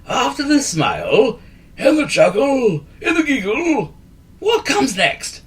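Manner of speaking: put-on a fussy old wizard correcting; wheezy wizard. A narrator's reading. Wizard quiz.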